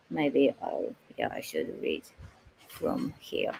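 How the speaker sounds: hushed whisper to herself; soft unvoiced muttering aside. Real, not acted. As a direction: drop to a near-silent whisper.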